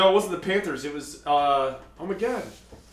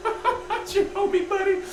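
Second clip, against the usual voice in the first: high squeaky mock threat; playful squeaky bravado; mock tough-guy squeaky voice.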